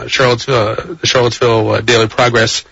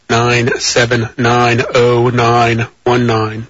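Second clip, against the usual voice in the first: very slow, every digit drawn out; deliberately slow; 3 semitones lower, much slower than usual. Shown as a demonstration. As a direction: read the number slowly for listeners who can't keep up. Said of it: "for those of you who think I'm speaking too quickly".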